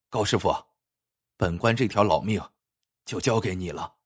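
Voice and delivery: low, subdued official entrusting his life; humble plea. A narrator's reading. Prefect bowing to the master carver, humbly pleading. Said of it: "最后还放下官架子，".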